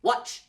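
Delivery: watch cut off with a sharp ch; watch with quick ch. Shown as a demonstration. Teacher contrasts Wash and watch, crisp ch. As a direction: snap the ch at the end of watch. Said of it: "Can you say both?".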